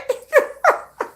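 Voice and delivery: loud, high burst of laughter; squealing laugh. Real, not acted. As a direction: crack up at your own story. Laughs at own retold reply.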